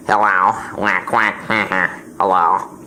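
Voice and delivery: deep mock voice for the vice president; Dick Cheney voice. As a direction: drop low and goof like a puppet sidekick. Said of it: "Say hello to y'all the people, Dick".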